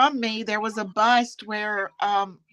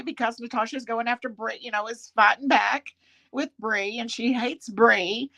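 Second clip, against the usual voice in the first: ordinary talk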